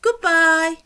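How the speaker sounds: high, loud sing-song "Goodbye."; cheery goodbye. Shown as a demonstration. Teacher waving off the class, bright loud goodbye. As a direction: call a bright farewell to the class. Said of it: "I might say".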